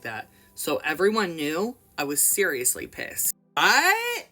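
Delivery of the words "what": high, startled what; a girl stunned after being scolded; shocked. Acted. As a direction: caught off guard and stunned. Shocked girl, eyes like saucers.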